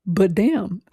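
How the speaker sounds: ordinary talk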